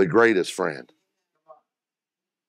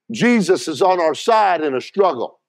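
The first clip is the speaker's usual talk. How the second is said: raised preaching voice; 6 semitones higher, 4 dB louder than usual. Acted. Preacher raising voice.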